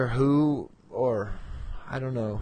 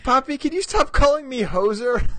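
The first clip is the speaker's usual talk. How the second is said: high, louder voice of his daughter complaining; imitating daughter; 12 semitones higher, 5 dB louder than usual. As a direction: whine it like an annoyed kid, pitched up. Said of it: "that's what she said today".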